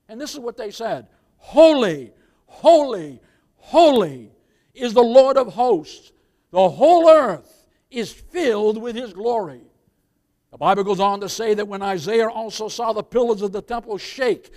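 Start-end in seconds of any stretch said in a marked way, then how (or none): 1.4-9.6 proclaiming loud, each holy ringing; crying out. Acted. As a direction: cry it out like angels before the throne. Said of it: "they cried out one to another".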